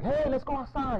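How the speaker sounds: put-on high, eager kid voice; kids inviting out. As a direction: call a friend outside like a kid. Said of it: "kids who are like".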